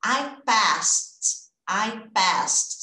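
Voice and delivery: "I passed" with the final -ed said as a plain T, no extra syllable; ending T sound. Shown as a demonstration. Teacher drilling the past form for students, T ending. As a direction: model "I passed" ending on a short T. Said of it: "É o mesmo som".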